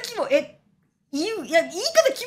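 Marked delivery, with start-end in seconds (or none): none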